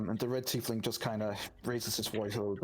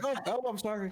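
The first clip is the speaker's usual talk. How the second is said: high, flustered yelp of sorry; mock flustered apology; 11 semitones higher, faster than usual. Narrator voicing Adriel toppling off chair, high flustered. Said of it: "Adriel falls off the chair going".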